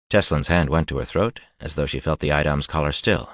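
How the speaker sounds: plain reading voice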